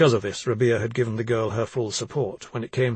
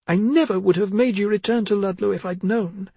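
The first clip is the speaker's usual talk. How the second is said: distressed mother; 9 semitones higher, 4 dB louder than usual. Acted. Mother laments.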